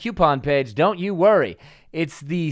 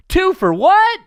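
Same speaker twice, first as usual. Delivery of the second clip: high, loud mock-incredulous title call; big game-show style title shout; segment title announcement; 13 semitones higher, 6 dB louder, slower than usual. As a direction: shout the segment name as a rising question.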